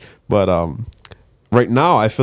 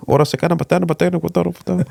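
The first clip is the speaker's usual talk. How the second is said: made-up Spanish-sounding gibberish, pitched much higher; mock Mexican accent; 9 semitones higher, much faster than usual. Comic showing a podcast host his mock Mexican accent, pitched high and fast. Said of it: "It's kinda like that cadence".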